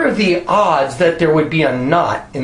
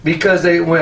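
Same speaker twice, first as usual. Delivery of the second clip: ordinary talk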